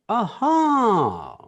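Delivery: high, loud drawn-out aha; knowing aha. Shown as a demonstration. Teacher demoing tone to the class with a loud aha. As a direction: act like you just caught on. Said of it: "This is the tone of voice".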